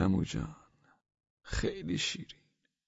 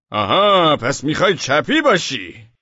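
taunting accusation; 4 semitones higher, 7 dB louder, slower than usual. Acted. Policeman twisting his words.

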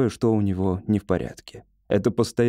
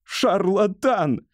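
high, drawn-out accusing cry; cried out; 10 semitones higher, much slower than usual. A narrator's reading. Patient denounces his doctor after a pause, drawn-out cry. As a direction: stretch the insult out high. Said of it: "выкрикнул".

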